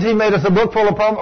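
ordinary talk

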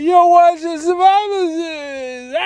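high, slow, bad [name] drawl with a laugh; [name] impression. [name] on the big screen at Survivor Series, a high slow bad [name] impression. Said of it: "it sounds nothing like it at all".

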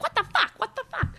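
put-on high-pitched squeaky kid swearing; gleeful child. Young son completing mom's unfinished phrase, gleeful high child voice. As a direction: imitate a little kid gleefully finishing the curse.

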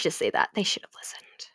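dark hushed whisper. Real, not acted. About the Order's fate, a sinister whisper. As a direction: say it as a sinister aside. Ominous aside to viewers.

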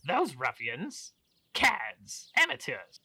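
sneering nobleman, words dragged out; scornful aristocrat. Acted. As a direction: spit out each insult with disdain. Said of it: "busts out laughing".

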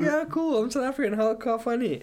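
high, bright-voiced retelling; quoted instructor, pitched high; imitating the instructor. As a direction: chirp it back like a delighted stranger. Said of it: "He's like".

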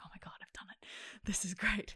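hushed, whispered disbelief to oneself; awed whisper. Retold or imitated. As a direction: whisper it like a secret triumph. Retold awed private realisation.

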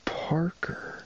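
high, breathy mock call; mimicked movie cry. Quoting a film character calling up five stories, mock cry. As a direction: call the name up high, with little voice. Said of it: "but goes like".

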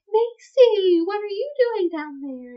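high, loud, scandalized snob parents; mock-shocked parents. Voices shocked parents spotting Macy.

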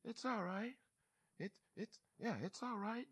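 high, soft reassurance; soothing dad. Acted. Dad reassuring the child he lifted up, high soft soothing. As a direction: comfort a small child, voice lifted and hushed. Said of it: "And dad's like".

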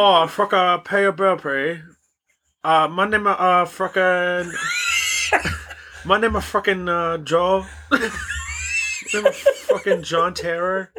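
stumbling mock Bangladeshi scammer, close to cracking up; badly faked Bangladeshi accent. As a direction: grope for the name in a bad Bangladeshi accent. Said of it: "can't do that accent".

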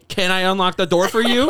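high, loud, sassy offer. Retold or imitated. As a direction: snap it out with sass. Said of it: "got really sassy about it".

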